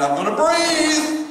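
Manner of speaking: high, slow stretch on breathe; slow rising breathe; breathe deliberately. Shown as a demonstration. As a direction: lift and draw out breathe. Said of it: "I'm not gonna let it get out of hand".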